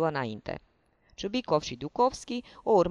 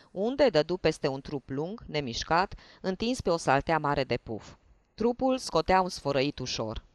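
ordinary delivery, nothing marked

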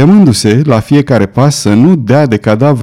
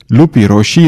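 ordinary delivery

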